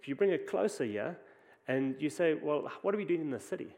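usual speaking voice

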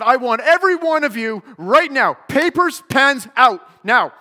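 loud, high, barked classroom command; furious orders. Retold or imitated. Teacher storming in, ordering students to get papers out, furious shout.

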